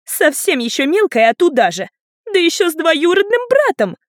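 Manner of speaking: loud, high, scandalized squeal. A narrator's reading. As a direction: build to a shrill, outraged finish. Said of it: "завизжала сестрица". (визгливо, возмущённо)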